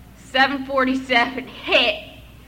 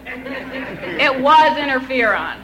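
laughing; 3 semitones higher, 7 dB louder, slower than usual. Real, not acted. Laughing at own fear.

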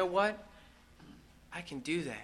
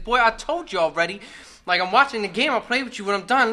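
louder and higher, losing patience; fed-up dad; 6 semitones higher, 10 dB louder than usual. Retold or imitated. Dad scolding his son to stop bugging him, loud and fed up. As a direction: scold the boy for asking twice. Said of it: "He's like".

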